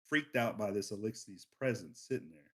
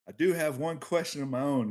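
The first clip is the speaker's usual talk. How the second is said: badly put-on Drifter accent, pitched up and louder; horrible Drifter accent; 5 semitones higher, 5 dB louder than usual. A narrator's reading. Drifter questions the big Eliksni, in a horrible put-on accent. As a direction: play Drifter with an amateurish accent. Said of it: "this is my horrible Drifter, uh, accent".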